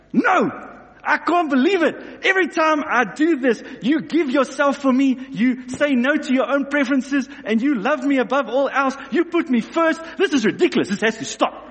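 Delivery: can't believe how loved she is; overwhelmed wife. Acted. Wife stunned by her selfless husband, high and amazed.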